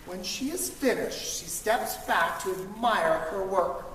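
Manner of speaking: usual speaking voice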